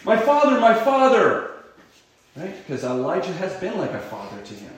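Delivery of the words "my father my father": loud, high anguished cry; desperate loud cry of grief. Acted. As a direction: cry out to a departing father. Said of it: "he cries out".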